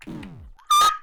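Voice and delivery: sudden high-pitched shriek; a shrill yelp of shock; scream. Real, not acted. Gamer hit by the boss, short high shriek.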